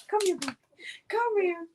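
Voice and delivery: high coaxing call; pet-calling voice. Real, not acted. Owner calling her dog to come closer, high coaxing voice.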